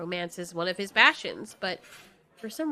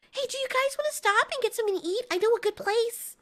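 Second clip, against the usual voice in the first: high, quick, eager youngster; chirpy kid offering food; young Marley voice; 13 semitones higher than usual. Acted. Marley the cheerful kaiju speaks.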